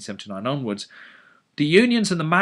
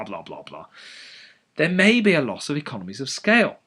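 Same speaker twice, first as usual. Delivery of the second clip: usual speaking voice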